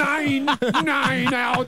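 put-on high, hammy German accent. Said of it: "Can you say it in a German accent?".

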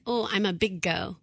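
put-on "go" for "girl", R left out; dropped R's. Mother imitating her daughter, "girl" without its R. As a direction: say "girl" as "go", like a toddler. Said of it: "she doesn't say her R's".

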